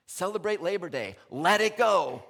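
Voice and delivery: loud rallying call; exhorting shout. Real, not acted. Minister urging the congregation, loud and joyful. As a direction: throw it out to the room like a cheer.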